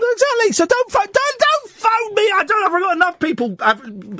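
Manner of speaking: very high, exasperated protest; mock indignation. Host telling off a caller for phoning, high mock indignation. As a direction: squeal in comic exasperation.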